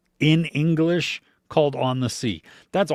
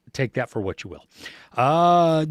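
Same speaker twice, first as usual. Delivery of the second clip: ordinary talk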